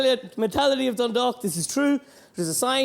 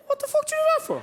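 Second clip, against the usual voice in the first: high, squeaky outraged yelp; a hammed-up high outraged landowner; outraged farmer; faster than usual. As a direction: screech it like a furious little man. Landowner yelling at Fionn.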